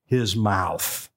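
low, very slow, restrained anger; frustrated patience, drawn out low and slow; a touch of stern, measured anger; a little angry. Retold or imitated. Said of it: "He was getting a little angry".

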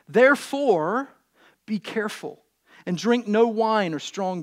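projected, measured reading with weighted key words; scripture reading. Acted. Preacher reads Samson's birth story, scripture reading. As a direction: proclaim the Bible story to the room.

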